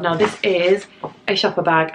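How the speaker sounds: ordinary talk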